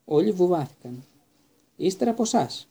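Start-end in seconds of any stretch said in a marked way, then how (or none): 1.8-2.7 high, playful after-you to a friend; smiling. Acted. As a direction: offer it with a grin. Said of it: "είπε ο Ρον χαμογελαστά".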